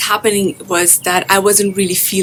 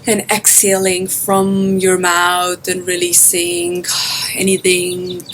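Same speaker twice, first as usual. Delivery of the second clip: guided meditation. Acted. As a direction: guide listeners gently into their breath, drawing out phrase endings.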